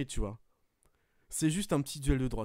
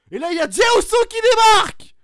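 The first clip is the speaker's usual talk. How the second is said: play-by-play high and loud, going up; commentaire survolté; 12 semitones higher, 12 dB louder than usual. Acted. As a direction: commente le match comme en direct, à fond. Commentary of the match. (commentateur de catch surexcité)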